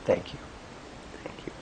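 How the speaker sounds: quiet thanks. Shown as a demonstration. Modelling quiet thanks to past selves.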